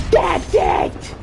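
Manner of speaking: high furious shriek; Karen shout. Retold or imitated. Karen mom snapping at the kid, high furious shout. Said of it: "He's like".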